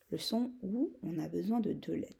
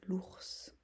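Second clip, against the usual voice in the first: l'ours whispered softly, unvoiced; whispered word; 8 dB quieter than usual. Shown as a demonstration. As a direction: breathe l'ours out as a soft dictation whisper. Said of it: "répétition chuchotée du mot". (l'ours chuchoté doucement)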